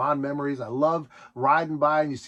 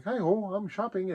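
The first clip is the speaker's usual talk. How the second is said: Kermit voice; 5 semitones higher, 5 dB quieter than usual. Retold or imitated. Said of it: "he does his Kermit voice and he's like".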